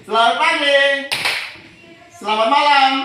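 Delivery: high, loud classroom cues; called greetings. Shown as a demonstration. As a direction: call each greeting out to the class. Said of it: "sudah konsentrasinya". (salam diserukan keras kepada kelas)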